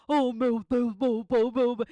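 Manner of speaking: loud hoarse mock preacher calling on God; hoarse radio preacher. Mother's radio preacher Davi Miranda, loud and hoarse. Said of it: "ele falando com aquela voz rouca".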